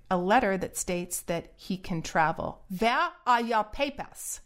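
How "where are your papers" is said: mock German officer demanding papers in a bad German accent; hammed-up German accent, a stern checkpoint question. Mocking a German official asking for papers, bad German accent. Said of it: "my bad German accent right there".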